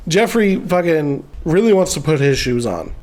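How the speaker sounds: ordinary talk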